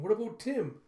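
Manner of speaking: put-on high, loud little boy's plea; a kid begging for his dog; worried child. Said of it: "you see Andy asking".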